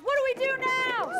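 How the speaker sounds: high, loud panicked yell. Acted. Pirate yelling to the crew in the storm, panicked yell. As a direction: cry it out in panic. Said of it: "One of the pirates yelled".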